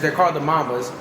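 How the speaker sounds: ordinary talk, nothing marked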